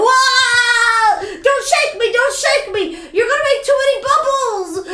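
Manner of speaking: high, squealing mock-panic voice; voz aguda de juguete asustado. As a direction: squeal in pretend alarm, drawing out the whoa. Mimics plushies being shaken in water.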